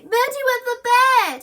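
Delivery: high, loud howling taunt; howled. A narrator's reading. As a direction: howl it out. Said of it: "howled Susie".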